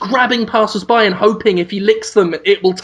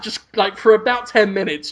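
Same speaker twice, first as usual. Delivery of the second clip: ordinary delivery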